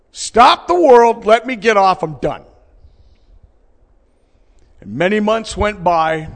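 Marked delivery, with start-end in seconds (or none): 0.1-2.5 loud, high despairing shout; raised-voice plea, dropping at the end; desperate outcry. Retold or imitated. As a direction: cry out as if at the end of your rope. Reliving his despair at full force.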